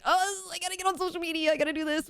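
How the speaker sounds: high, rushed, frantic babble; mock panic. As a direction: rattle it off in a flustered scramble. Imagined panic over surprise trailer.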